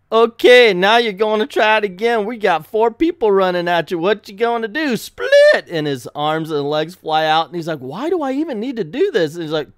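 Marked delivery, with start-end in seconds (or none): 0.1-5.7 loud, high-pitched challenge; hammed-up coach shouting a test; urgent drill instructor. Acts doctor drilling robot under attack. 7.8-9.3 high-pitched exasperated robot; mock indignant objection; whining protest. Acts robot protesting the exercise.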